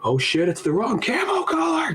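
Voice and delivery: high-pitched, hammed-up alarm; mock panicked cry. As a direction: cry out a sudden realisation in a high voice. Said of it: "He's just like".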